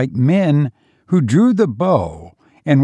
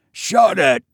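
a gruff high snap of a retort; mild bellow; 6 semitones higher than usual. A narrator's reading. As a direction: shut him up over the din. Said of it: "the other soldier bellowed".

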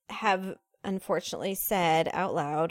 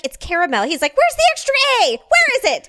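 high, full-volume re-enacted yelling; screaming argument; 10 dB louder than usual. Brother and sister fighting in the kitchen, screaming. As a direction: imitate siblings screaming over a word. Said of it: "they were screaming at each other".

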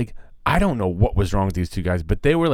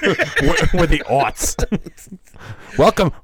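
giggling through the words; chuckling while riffing with a co-host; laughing; 7 semitones higher, 4 dB louder, slower than usual. Real, not acted. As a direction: laugh out the joke title. Laughing joke about podcast name.

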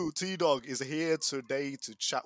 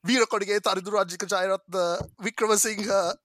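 loud, raised-pitch hype announcement; announcer introduction; 7 semitones higher, 12 dB louder than usual. Pretend. Host presenting the special guest, announcer-style. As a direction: proclaim the guest's name like a grand entrance.

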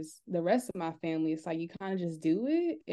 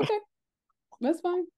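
gentle grandma; 11 semitones higher than usual. Retold or imitated. Grandma voiced accepting a no.

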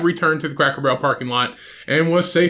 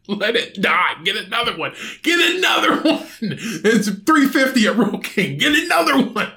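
exasperated yell; 8 semitones higher than usual. Pretend. Shouting at the absent owner to replace the chicken, exasperated laughing yell. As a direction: bellow the advice through laughter, fed up.

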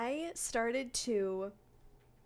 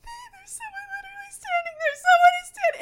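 high panicked shriek, much louder; terrified screaming; 9 dB louder than usual. Retold or imitated. Woman wakes up screaming to her sleeping husband, high terrified scream. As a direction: scream in terror at a figure in the dark. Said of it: "And I was screaming like that".

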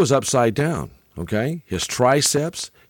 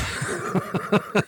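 silent wheezing laugh; breathless laugh; 8 semitones higher, 6 dB quieter than usual. Real, not acted. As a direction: laugh without voice, helplessly amused. Laughing at the reward story.

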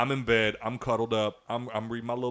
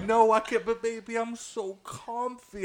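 mock sleepy whine; whiny comfy partner; 10 semitones higher, slower than usual. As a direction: ham up her lazy pout. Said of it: "she was like".

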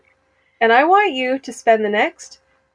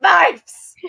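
mock Essex; 6 semitones higher than usual. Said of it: "sometimes Essex".

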